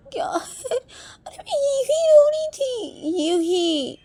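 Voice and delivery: very high, stretched-out wail of protest; exasperated whine. Acted. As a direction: squeal the denial, dragging it out. Exasperated repeated denial. (खीझकर बहुत ऊँची, खिंची आवाज़ में)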